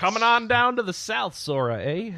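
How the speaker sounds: a lightly put-on Southern accent; bad Southern accent. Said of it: "that's the worst accent".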